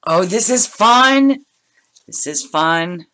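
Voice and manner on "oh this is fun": delighted. Real, not acted. Delighted unboxing exclamation.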